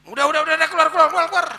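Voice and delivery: high, loud driving-away shout; mock shout. Rich man chasing the beggar out, shouting. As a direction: bark him out the door.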